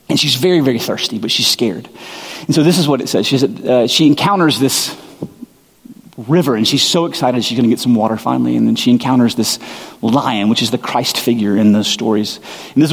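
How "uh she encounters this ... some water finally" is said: usual speaking voice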